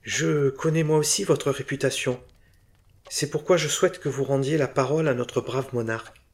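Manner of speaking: a keen bric-a-brac dealer pleading his case; eager antique dealer. Acted. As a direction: press your request with keen hope. Bourdet voiced higher.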